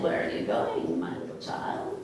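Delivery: very low wolf voice; wolf's question in a deep, hushed tone. Acted. Wolf meets girl at the crossroads, low wolf voice. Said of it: "And the wolf said".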